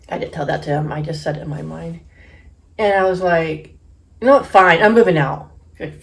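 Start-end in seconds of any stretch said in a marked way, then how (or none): 4.2-5.5 loud, punchy retort in a retold quarrel; fed-up defiance. Daughter announcing to dad she is moving out, acted defiant anger. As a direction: act out a daughter declaring she's done.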